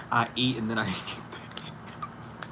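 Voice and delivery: whispered laugh through the words. Real, not acted. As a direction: giggle it under your breath. Laughing at own hiccups.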